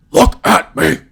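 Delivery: loud, harsh demand for attention; mock desperate shout. Comic to every girl at a bar, mock desperate shout. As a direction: yell it as a hammed-up pickup line.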